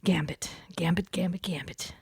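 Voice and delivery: hushed, low whisper; whispered name. Real, not acted. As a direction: trail down into a whisper on the beloved name. Repeats beloved name, fading to whisper.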